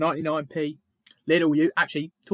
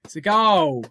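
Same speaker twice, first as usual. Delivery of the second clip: loud, raised-pitch celebration; excited goal shout; 4 semitones higher, 9 dB louder, slower than usual. Real, not acted. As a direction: shout it out as your team scores. Announcing a goal.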